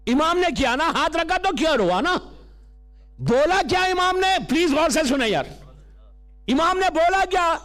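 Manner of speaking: impassioned sermon delivery; high, insistent preacher's projection; preaching. Acted. Preacher questioning the congregation on the Imam's words, preaching.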